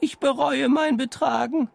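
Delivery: voice from the depths. A narrator's reading. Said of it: "ertönte die Stimme".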